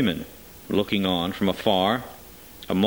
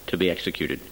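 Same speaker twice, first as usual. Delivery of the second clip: usual speaking voice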